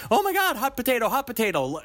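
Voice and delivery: high cartoon panic; mock panic. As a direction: juggle it like a hot potato. Imitating panicked cartoon juggling.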